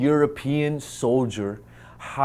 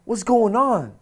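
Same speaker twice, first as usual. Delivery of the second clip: panicked pleading; 7 semitones higher, 4 dB louder than usual. Retold or imitated. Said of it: "And I was like".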